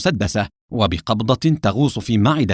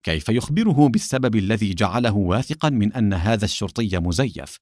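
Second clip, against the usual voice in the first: plain reading voice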